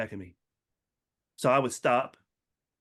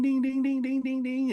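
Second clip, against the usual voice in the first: high sing-song ding ding; imitated crossing bell; 14 semitones higher than usual. Describing the train crossing, imitating the bell high.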